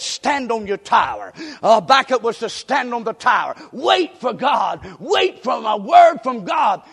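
slow, loud shouted preaching; preaching at full force. Real, not acted. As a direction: hammer each word at the congregation.

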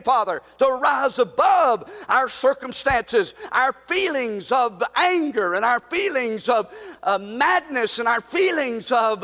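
rising, impassioned prayer; preacher praying at full force over the church; fervent prayer. Acted. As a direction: pour it out to God.

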